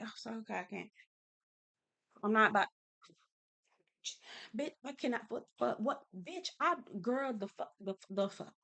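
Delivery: under her breath, too shocked to believe it; muttered disbelief. Retold or imitated. Recapping the reality show cast, she mutters in disbelief. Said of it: "I had to, like".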